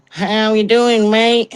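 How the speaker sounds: high, slow, over-friendly tourist voice; hammed-up American tourist greeting; mimicking tourists. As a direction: greet like an eager visitor hunting a monster. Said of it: "you get the".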